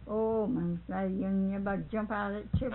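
ordinary delivery, nothing marked

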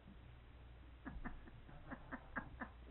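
soft, breathy high laugh; laughter. Real, not acted. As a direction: laugh quietly, mostly on breath. Shared laughter between the speakers.

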